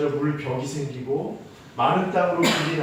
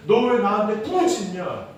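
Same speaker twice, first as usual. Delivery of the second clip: higher-pitched rhetorical challenge; acted reproach; 6 semitones higher, 5 dB louder than usual. God questioning Moses' cry, reproachful retelling. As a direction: voice God's puzzling retort with force. God's reply repeated with more force.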